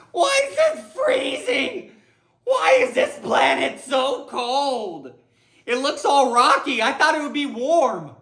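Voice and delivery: high, half-laughing, half-crying whine; frantic, overwhelmed complaining; panicky. Pretend. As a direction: panic about the cold planet. Panicked astronaut rant.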